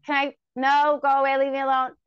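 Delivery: mock yell at brother. Teen to little brother at the door, loud mock yell. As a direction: shoo a pestering little brother away, loud. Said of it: "And I'm like".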